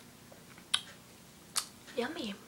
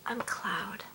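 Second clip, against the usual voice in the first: whispering. Real, not acted. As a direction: giggly whisper. Joke whispered with laugh.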